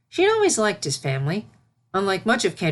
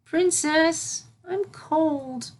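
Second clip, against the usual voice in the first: small complaint of cold; cold filly; 11 semitones higher, much slower than usual. A narrator's reading.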